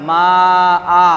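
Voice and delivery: long-held মা, then an open long আ; pausal elongation. Shown as a demonstration. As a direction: stretch মা and end on আ without n. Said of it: "কি পড়তে হবে?".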